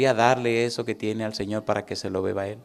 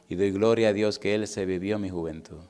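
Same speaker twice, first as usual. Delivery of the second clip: ordinary delivery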